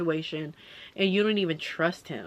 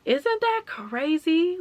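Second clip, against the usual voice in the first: amazed; 10 semitones higher, 3 dB louder, much slower than usual. Real, not acted. Marvelling at small-world coincidence.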